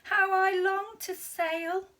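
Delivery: a tiny snail yearning for adventure; longing snail. A narrator's reading. Tiny snail dreaming of the sea aloud. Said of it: "said the tiny snail".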